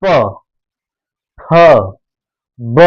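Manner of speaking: प फ ब said with both lips closing; teacher modelling lip sounds प फ ब; lip sounds demo. Shown as a demonstration. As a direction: sound out प, फ, ब slowly, lips pressing together. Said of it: "हमारे साथ बोलिए".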